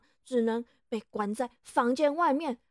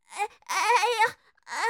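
sudden cries of pain, higher and louder; pained cry; 6 semitones higher, 7 dB louder than usual. Acted. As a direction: cry out as the labor pain hits. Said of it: "痛的叫了起来". (痛得叫出来)

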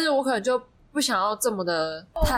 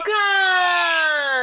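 shocked exclamation; 7 semitones higher, 4 dB louder than usual. Real, not acted. Shocked outburst.

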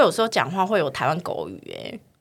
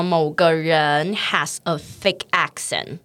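slow, careful "has a thick accent"; teacher pacing "thick accent" slowly; slow teaching model; 3 semitones lower, slower than usual. Shown as a demonstration. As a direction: model each word for students. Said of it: "来学一下".

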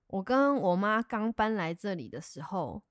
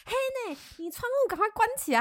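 put-on high urgent old man; old man urging; 13 semitones higher than usual. Old pest man tells her to close windows, urgent old man voice. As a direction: hurry her to shut the window.

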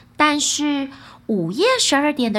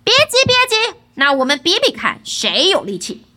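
high, loud call easing into a challenge; 5 semitones higher, 6 dB louder than usual. A narrator's reading. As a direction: call out boldly to the old fiend. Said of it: "儿子喊着". (高声喊着挑战)

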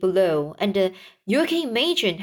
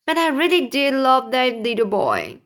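lightly acted sob, higher in pitch; sobbing; 4 semitones higher, 3 dB louder than usual. Earthworm confessing love for the boy, mildly acted sob. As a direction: protest tearfully, but understated. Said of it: "the earthworm sobbed".

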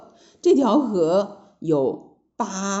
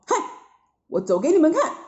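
higher, confident show-off; fearless Sun Wukong; 9 semitones higher, faster than usual. A narrator's reading. Monkey disciple offers to demonstrate the crossing, fearless. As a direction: toss it out boldly, pitched up. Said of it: "孙悟空能害怕吗".

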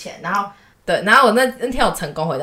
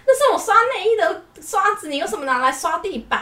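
mock yell; 11 semitones higher than usual. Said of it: "然后他就大叫".

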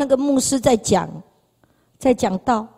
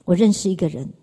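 usual speaking voice

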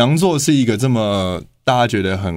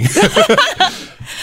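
high embarrassed giggle; embarrassed laugh. Real, not acted. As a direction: laugh it off after the slip. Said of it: "我怎么破音". (尴尬地笑)

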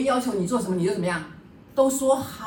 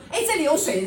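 lightly imitated fussy husband, higher and louder; 7 semitones higher, 4 dB louder than usual. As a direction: play the husband pointing out every drop of water. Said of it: "他就在那边地上说".

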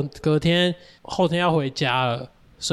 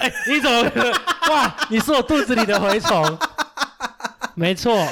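laughing; 6 semitones higher than usual. Real, not acted. Friend laughing at the guessed revenge. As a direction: laugh as your guess lands.

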